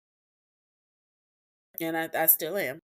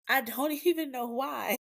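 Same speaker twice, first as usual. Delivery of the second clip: light laugh through "I don't even know why"; 7 semitones higher than usual. Real, not acted. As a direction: laugh at yourself, higher.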